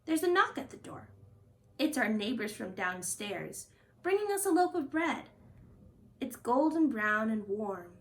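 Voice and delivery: ordinary delivery, nothing marked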